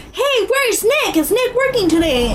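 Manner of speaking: hammed-up eager customer calling; mock calling out. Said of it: "yelling for you".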